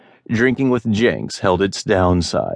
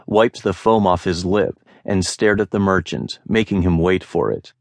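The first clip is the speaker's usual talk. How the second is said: ordinary delivery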